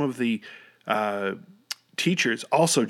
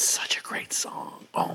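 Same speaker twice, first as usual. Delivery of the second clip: breathless whisper. Real, not acted. Awestruck after the lyrics.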